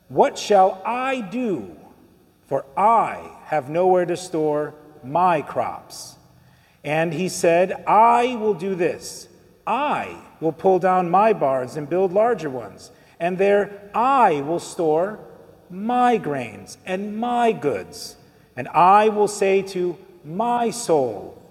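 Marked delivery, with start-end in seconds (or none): 0.1-6.2 slow, weighty stress on "I" and "my"; emphatic reading. Retold or imitated. Preacher reads the rich man's words to congregation, slowly stressing "I" and "my". 7.9-21.4 slow, weighty stress on "I" and "my"; emphatic reading. Retold or imitated. Preacher reads the rich man's words to congregation, slowly stressing "I" and "my".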